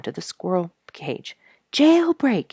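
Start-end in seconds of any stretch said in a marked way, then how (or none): 1.7-2.5 high, loud cry of alarm; cried out. A narrator's reading. Duke crying out from the squirrel cage, loud alarm. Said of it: "he cried".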